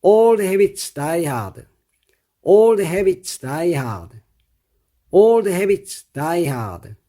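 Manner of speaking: very slow, each word laid out for learners; slow model reading. Shown as a demonstration. Teacher to students, very slow model reading. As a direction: read it out slowly for students to copy. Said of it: "이렇게 되는 겁니다".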